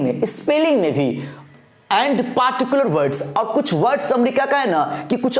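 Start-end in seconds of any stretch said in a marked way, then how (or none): 1.9-3.4 slow dictation. Acted. Rereads the text slowly.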